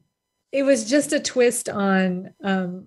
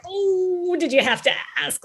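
drawn-out, higher "oh", playfully mortified; mock embarrassment; 8 semitones higher than usual. Romance reader asked by the acupuncturist what she's reading, mock-embarrassed. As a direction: imitate an embarrassed groan of a question. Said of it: "Like, I'm all embarrassed".

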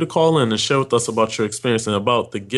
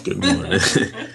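laughing; 3 semitones lower, slower than usual. Real, not acted. Host greets co-host, laughing.

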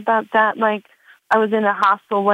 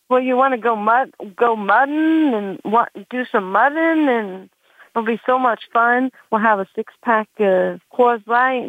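put-on Oklahoma accent; 2 semitones higher than usual. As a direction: draw it out like a guy from Oklahoma. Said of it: "a guy from Oklahoma".

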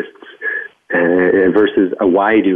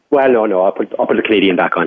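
ordinary talk

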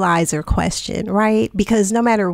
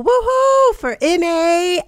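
excited cheer; 9 semitones higher than usual. Real, not acted. Host cheers the guest.

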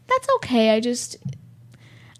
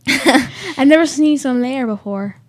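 laughing; 3 semitones higher, 6 dB louder than usual. Real, not acted. Laughing after own sneeze.

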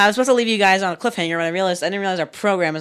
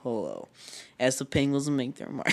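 very low, soft documentary narration; deep, hushed nature film voice; Morgan Freeman; 6 semitones lower, 11 dB quieter than usual. Pretend. Continuing the Morgan Freeman bit as a nature documentary, deep and soft. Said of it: "Morgan Freeman's voice".

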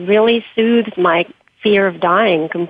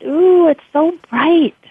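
slow, high, louder ooh of delight; laughing in wonder; 9 semitones higher, 4 dB louder, slower than usual. Acted. Dying friend seeing the bright light, laughing wonder. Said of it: "She laughed".

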